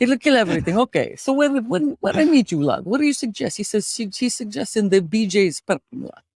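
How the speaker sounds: put-on storyteller with a Russian accent. Host telling co-host about car accident, Russian accent. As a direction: tell a tale of woe in a Russian accent. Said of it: "could you do a Russian accent".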